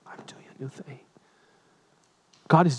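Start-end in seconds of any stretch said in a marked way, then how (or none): 0.1-1.0 a hushed whisper, fading to almost nothing; God speaking softly. Acted. Preacher voices God to the congregation in a fading whisper. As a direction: whisper it like a voice from heaven. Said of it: "And God said".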